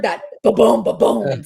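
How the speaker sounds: loud booming truck noise, slowing right down on the second one; garbage truck boom. Retold or imitated. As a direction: mimic the thud of a dumpster being emptied. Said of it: "the big garbage trucks when they're emptying a dumpster".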